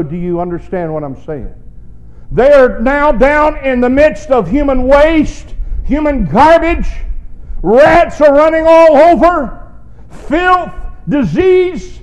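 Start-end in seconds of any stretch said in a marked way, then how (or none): 2.3-12.0 loud, rising preacher's build; preaching at full force. Acted. Preacher building to the congregation, full force. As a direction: drive it home like a Sunday preacher.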